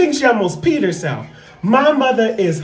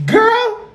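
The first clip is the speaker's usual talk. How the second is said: high squealed laugh. Real, not acted. Laughing in disbelief at the niece's roast, a high squeal.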